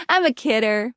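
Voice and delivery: ordinary delivery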